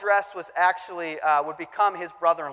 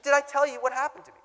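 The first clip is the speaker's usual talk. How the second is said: much higher, fast, excited repeat; very eager question; 10 semitones higher, faster than usual. Retold or imitated. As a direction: ask it breathlessly eager, higher and faster. Repeated, even more eager.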